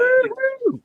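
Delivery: very high, slow, loud whoop; over-the-top imitation of an American fan's whoop; mock woo-hoo cheer. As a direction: let out a hammed-up fan cheer. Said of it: "Like, just".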